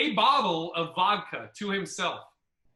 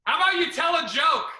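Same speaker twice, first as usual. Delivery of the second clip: heckler; 9 semitones higher, 6 dB louder than usual. Retold or imitated. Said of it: "Guy in the front row goes".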